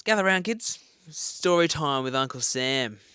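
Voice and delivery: put-on storyteller uncle calling the kids; loud old-timey storyteller voice; elder telling story. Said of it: "that sounds weird".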